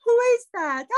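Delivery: very high, loud staged surprise; mock astonishment. Actress discovering who is at the window, mock astonishment. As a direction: squeal the question in put-on amazement.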